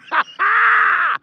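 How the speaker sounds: a sudden, high, shouted laugh. Real, not acted. Reacting to a big donation.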